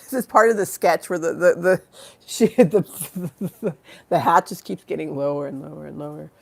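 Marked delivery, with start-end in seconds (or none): none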